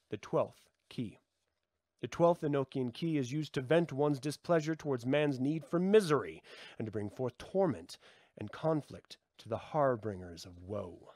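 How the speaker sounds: normal talking voice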